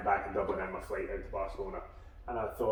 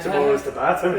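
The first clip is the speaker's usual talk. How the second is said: louder, higher, words through laughter; laughing; 5 semitones higher, 12 dB louder than usual. Real, not acted. Friend jokes about the next training day, laughing through the words. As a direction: get the joke out while still laughing.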